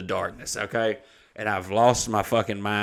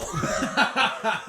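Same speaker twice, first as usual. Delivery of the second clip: high laugh; 11 semitones higher than usual. Real, not acted. Laughs at own joke.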